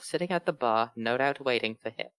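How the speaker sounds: ordinary delivery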